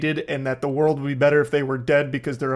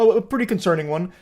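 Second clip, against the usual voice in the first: ordinary talk, nothing marked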